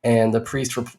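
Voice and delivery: ordinary talk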